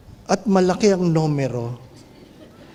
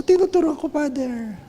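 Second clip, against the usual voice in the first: mother's reply; 9 semitones higher than usual. Retold or imitated.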